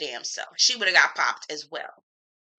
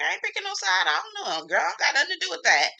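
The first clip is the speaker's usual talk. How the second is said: much higher, hammed-up party girl dodging sides; mock high girl voice; 11 semitones higher, faster than usual. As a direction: squeak it out, a quick fast brush-off. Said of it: "And she was like".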